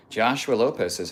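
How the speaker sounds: ordinary talk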